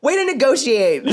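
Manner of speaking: high, laughing mock jab; teasing while laughing; laughing quote. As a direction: toss it out through a laugh. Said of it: "I'm like".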